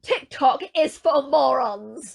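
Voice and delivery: loud, slow mocking mum impression; [name]. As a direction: send up your mother, every word drawn out. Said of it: "She's actually mocking her mum there".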